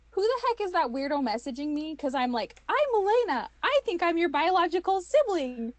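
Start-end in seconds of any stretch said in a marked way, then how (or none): 0.1-2.0 high, mock-incredulous question; imagine being baffled by a weirdo; baffled, higher-pitched mock reaction. Imagining a stranger's reaction to her message. 2.7-5.7 high, loud, hammed-up announcement; mock-eager, far higher and louder; over-eager introduction. Mimics her own awkward introduction message.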